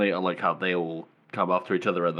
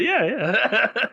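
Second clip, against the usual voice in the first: laughing agreement; 4 dB louder than usual. Retold or imitated. Crowd humouring him, acted with laughing.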